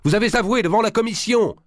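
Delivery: accusation criée, très haute et forte; cri de colère. A narrator's reading. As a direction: hurler le démenti, tremblant de colère. Marigny accuse le grand maître. (Marigny crie au grand maître, furieux)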